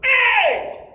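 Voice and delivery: high, loud shout across the playground. Retold or imitated. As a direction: yell it to get his attention. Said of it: "I would go".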